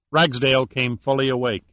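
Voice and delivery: ordinary delivery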